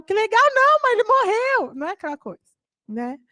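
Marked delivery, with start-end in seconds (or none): 0.0-1.7 put-on high, loud protesting kid; indignant child. Daughter scolds distracted mother, high indignant protest. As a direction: catch mom not listening.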